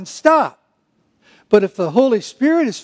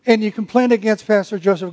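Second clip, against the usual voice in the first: usual speaking voice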